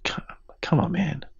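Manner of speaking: soft unvoiced coaxing whisper; whispered plea. Real, not acted. Coaxing his co-host to do the accent, in a whisper. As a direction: whisper to coax him on. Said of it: "pleading whisper".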